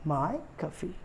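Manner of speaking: माय खफी repeated softly, "MY, kuh-fee"; correct pronunciation. Shown as a demonstration. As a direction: demonstrate माय खफी with "my" set apart. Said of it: "इसका correct pronunciation है".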